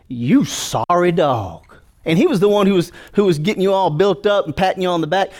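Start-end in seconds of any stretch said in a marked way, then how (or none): 0.1-1.6 slow, contemptuous sneer; sneering devil. Retold or imitated. Devil sneering at the fallen believer, slow. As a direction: drag out the insult slowly. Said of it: "he's gonna say".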